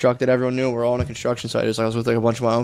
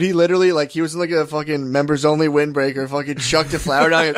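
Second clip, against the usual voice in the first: ordinary delivery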